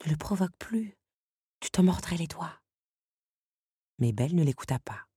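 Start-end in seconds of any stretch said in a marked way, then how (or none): 0.1-2.6 soft whisper of an inner voice; whispered warning. Acted. An inner voice warns Belle not to provoke him, whispering. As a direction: hush her from inside her head. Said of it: "lui souffla une voix".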